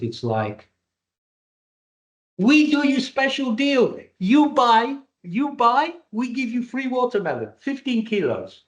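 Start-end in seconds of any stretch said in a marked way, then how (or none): 2.4-5.9 high, sing-song Greek Cypriot salesman; hammed-up Greek Cypriot trader pitch; Greek Cypriot accent. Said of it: "This is where I should really do my Greek Cypriot accent". 6.1-8.6 high, sing-song Greek Cypriot salesman; hammed-up Greek Cypriot market-stall offer; Greek Cypriot accent. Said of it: "This is where I should really do my Greek Cypriot accent".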